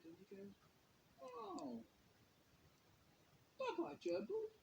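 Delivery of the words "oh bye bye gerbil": put-on high, wistful little-boy goodbye; a small child sadly waving off his pet; sad toddler voice. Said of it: "all sad".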